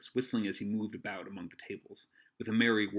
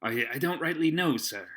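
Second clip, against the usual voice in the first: raised, stammered seaman's reply; hesitant sailor; 5 semitones higher, 7 dB louder than usual. Acted. Morgan answers Silver's grilling, hesitant. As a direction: answer defensively with a slight stumble.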